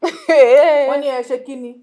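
loud retort. Acted. Friend retorting angrily.

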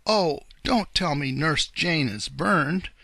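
slow, loud cry of mild alarm. A narrator's reading. Uncle Wiggily fearing for Nurse Jane, alarmed cry. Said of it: "cried Uncle Wiggily".

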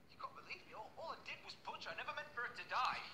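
high, hushed, desperate pleading; distressed confession. Acted.

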